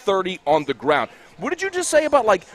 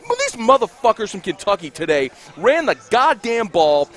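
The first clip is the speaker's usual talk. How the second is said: usual speaking voice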